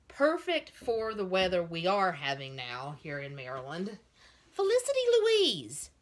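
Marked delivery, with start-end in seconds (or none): 4.5-5.9 high, loud full-name reprimand; scolding call. Real, not acted. Owner catches her cat at the doll clothes, scolding shout. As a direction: call out sharply to a misbehaving pet.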